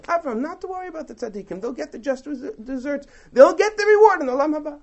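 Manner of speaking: reassuring divine reply. Pretend. God reassuring Avram about the righteous, lifted comforting tone. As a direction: reassure Avram not to worry. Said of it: "He could have simply been told".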